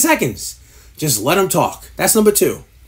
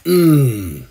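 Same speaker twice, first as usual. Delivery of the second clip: low drawn-out hum; mock profound hum; 8 semitones lower than usual. Mocking a host humming after a trivial story, low hum. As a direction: hum like it was deep wisdom.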